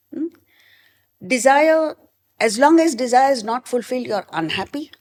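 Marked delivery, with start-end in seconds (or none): 1.3-4.1 desire punched high and loud; emphatic desire. Real, not acted. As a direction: hit Desire hard, then press on. Preacher stressing key word.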